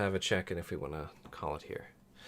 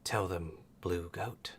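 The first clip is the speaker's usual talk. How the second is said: snake mom voice; 4 dB louder, slower than usual. Acted. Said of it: "Snake Mom's voice".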